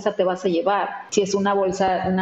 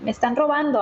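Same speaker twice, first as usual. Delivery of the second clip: higher-pitched retold cry of alarm; half-voiced shout, pitched up; mild mock yell; 7 semitones higher than usual. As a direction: imitate a friend calling out, without real volume. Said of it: "de la nada así grita así como".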